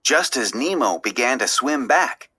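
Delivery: ordinary delivery, nothing marked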